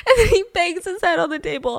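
laughing. Real, not acted. Host retelling the head-bang, in real laughter. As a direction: push through the giggles.

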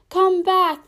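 loud, high call; calling after someone. A narrator's reading. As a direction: call out to someone walking away. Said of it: "the caterpillar called after her".

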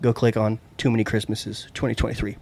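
usual speaking voice

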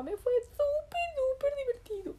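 high-pitched delighted gush. Acted. Rabbit tells mamá about his super fun day, gushing. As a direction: gush with childlike enthusiasm.

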